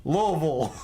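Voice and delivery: Louisville squashed into "LOH-vul"; two mushy syllables for "Louisville", "Lo-vul"; local pronunciation. Shown as a demonstration. Streamer repeating their Louisville for chat, local pronunciation. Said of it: "not Louisville".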